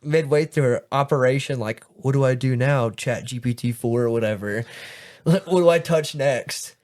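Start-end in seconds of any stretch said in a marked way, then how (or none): 1.9-6.7 lightly voiced lost surgeon, pitched up; mock a helpless trainee asking for instructions; louder, hammed-up cluelessness; clueless young doctor. Mocking clueless AI-reliant young surgeon.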